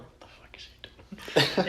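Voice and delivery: slow whispered disbelief. Retold or imitated. Tony leans over a shoulder, staring at her in whispered disbelief. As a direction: breathe it out, slow and secret. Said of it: "I'm like".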